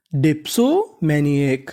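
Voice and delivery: very slowly sound out डिप्सोमैनिक; slow demo reading. Shown as a demonstration. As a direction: say डिप्सोमैनिक syllable by syllable. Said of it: "तो पूरी meaning".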